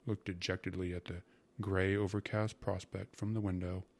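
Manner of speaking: ordinary delivery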